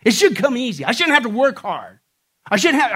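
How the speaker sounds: loud, mocking whine of the entitled; entitled complainer. Preacher mocking the world's entitlement, hammed-up whine. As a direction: mimic a demanding, spoiled voice. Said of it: "this mentality like".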